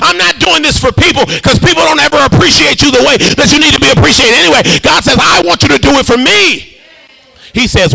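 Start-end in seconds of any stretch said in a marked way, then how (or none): 0.0-6.8 high, driving sermon delivery; full-force preaching. Real, not acted. Preacher declaring he lives for God, not people, fervent. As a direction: build in insistent, ringing phrases.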